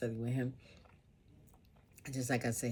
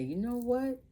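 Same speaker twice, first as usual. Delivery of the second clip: husband's interruption; 12 semitones higher, 3 dB louder than usual. Retold or imitated. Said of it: "he'll just say".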